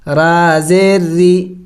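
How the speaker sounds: normal talking voice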